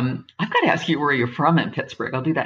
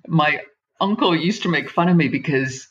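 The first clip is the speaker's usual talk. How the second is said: normal talking voice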